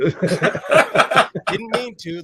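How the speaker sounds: laughing through it, slow and drawn out. Real, not acted. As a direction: let the laugh break the words. Laughing about Brady's wrong turn.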